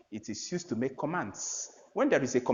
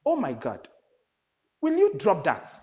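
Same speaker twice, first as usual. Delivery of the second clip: example of shock; 4 semitones higher, 4 dB louder than usual. Shown as a demonstration. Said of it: "any of such sentences".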